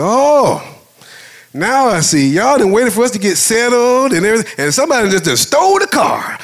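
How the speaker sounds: loud, high-pitched mock rant; wife fussing. Wife to anyone who would listen, loud imitated fussing. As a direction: imitate a fed-up wife complaining to the whole lot. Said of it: "she's just fussing out in the middle of the air".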